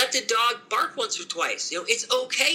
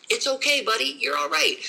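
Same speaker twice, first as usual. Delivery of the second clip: reassuring; 7 semitones higher than usual. Shown as a demonstration. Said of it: "explain to my dog through training and reassurance".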